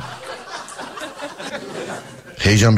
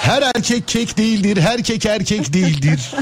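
slow, higher commercial announcer; 9 semitones higher, slower than usual. Acted. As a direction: deliver like a jingle slogan. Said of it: "reklam sesiyle".

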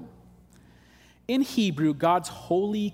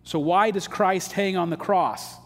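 ordinary talk

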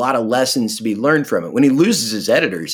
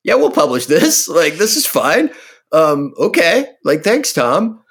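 breezy casual shrug; 5 semitones higher, 3 dB louder than usual. Retold or imitated. Publishers' breezy reply, retold.

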